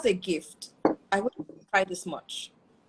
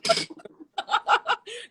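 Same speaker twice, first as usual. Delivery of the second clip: breathy high laughter; 14 semitones higher than usual. Real, not acted. Mother laughing at her own crying, high giggle.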